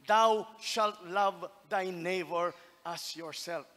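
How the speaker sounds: slow, loud preaching of scripture; measured declaration of a commandment; solemn scripture quote. Retold or imitated. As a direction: proclaim each line with weight. Quoting Jesus solemnly.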